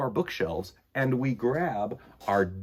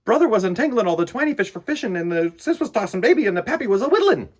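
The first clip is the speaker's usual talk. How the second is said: put-on fast, louder storytelling to children; lively read-aloud; 8 semitones higher, 7 dB louder, faster than usual. Reading a rhyming book to kids, fast and lively. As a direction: rattle off the cumulative list, quick.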